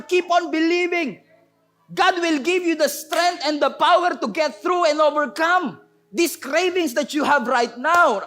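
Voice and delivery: high, fervent exhortation; preaching. Real, not acted. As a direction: urge them on with drawn-out conviction.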